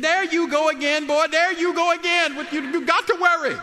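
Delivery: hammed-up scolding mama; imitating mama. Son mimicking his mother's exasperated scolding. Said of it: "She said".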